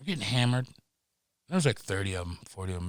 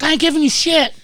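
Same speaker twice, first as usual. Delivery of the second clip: much higher, much louder cry; mock yell; 13 semitones higher, 6 dB louder, faster than usual. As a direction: yell it while fleeing the cops. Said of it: "I was like".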